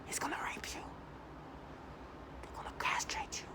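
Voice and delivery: hushed, unvoiced menacing whisper. Mimicking the perps' early taunts in a menacing whisper. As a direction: whisper it like a threat. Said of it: "they whisper, it's like".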